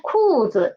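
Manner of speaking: 裤子 with falling 裤, low neutral 子. Shown as a demonstration. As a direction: drop sharply on 裤, land low on 子. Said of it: "the neutral tone is lower".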